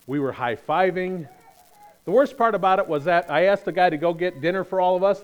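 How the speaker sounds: ordinary delivery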